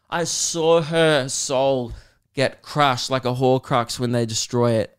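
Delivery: usual speaking voice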